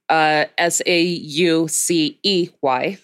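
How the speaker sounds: "S A U C E Y" spelled slowly, one letter at a time; spelling it out. Shown as a demonstration. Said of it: "Saucy spelled".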